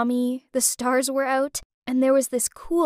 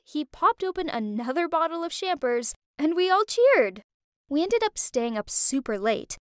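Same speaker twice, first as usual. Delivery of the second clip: ordinary delivery, nothing marked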